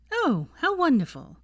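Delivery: high, loud mock delight; dripping sarcasm. A narrator's reading. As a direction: fake cheer that stings. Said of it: "Her voice dripped with sarcasm".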